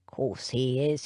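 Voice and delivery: low, quiet, slow dismissal; a hint of a sullen footman; William insists. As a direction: brush the doubt off, low and flat.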